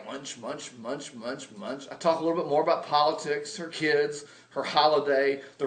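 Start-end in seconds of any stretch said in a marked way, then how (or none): 0.0-1.9 soft, low munch sounds; quiet munching. Retold or imitated. Nervously eating at sister's dinner table, quiet munching. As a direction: mumble munch munch under your breath. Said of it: "I just keep eating away".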